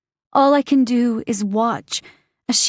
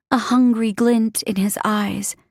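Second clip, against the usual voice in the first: plain reading voice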